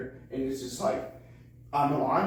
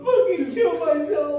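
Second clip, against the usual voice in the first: mock exasperation; 13 semitones higher, 7 dB louder than usual. Teammate's exasperation on the run.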